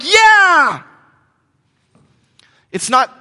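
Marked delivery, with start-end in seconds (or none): 0.0-0.8 much higher, loud mock cheer; crowd cheer. Pilgrims cheering back at the opener. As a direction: yell like a thrilled crowd. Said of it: "they're like".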